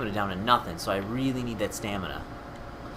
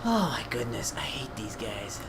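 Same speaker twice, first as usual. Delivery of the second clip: soft, slow whispered grumble; defeated, voiceless complaint; exasperated whisper; 6 dB quieter than usual. Real, not acted. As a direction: whisper your despair to yourself. Exasperated at game, to himself.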